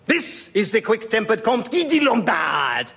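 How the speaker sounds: hammed-up French introduction; outrageous French accent. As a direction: present them grandly in a French accent. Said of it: "more outrageous than our own".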